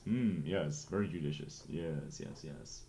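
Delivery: low, soft pompous newsman murmur; a smug anchor fading into silence; Anchorman voice. Acted. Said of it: "my Anchorman voice".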